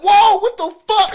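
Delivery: high, loud startled outburst. Retold or imitated. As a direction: jump back in shock. Startled by face in doorway.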